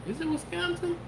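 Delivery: high, quiet falsetto question; soft high-pitched mock wondering; incredulous question. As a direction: squeak the question softly in disbelief. Debating a guess with himself.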